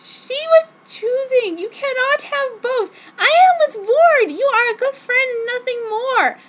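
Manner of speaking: high, loud shout near tears; tearful angry shout. Acted. Narrator shouting at Jacob, high and tearful. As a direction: shout it at him, crying with anger. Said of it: "I shouted at him".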